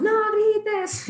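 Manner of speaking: mock yell of a frazzled parent; shouting. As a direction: bark it like an exasperated parent. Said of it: "y gritamos".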